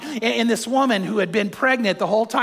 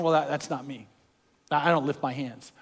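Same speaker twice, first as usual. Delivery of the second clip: low, soft mock objection; reluctant churchgoer; 7 semitones lower, 6 dB quieter than usual. Churchgoer refusing to lift hands, voiced low and reluctant. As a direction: mumble it low, imitating a reluctant worshipper. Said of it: "some of you going".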